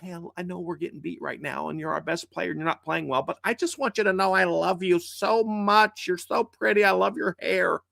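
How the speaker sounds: hammed-up gentle coaxing; mock sugary coach. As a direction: coo at the player like a baby. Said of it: "Would you".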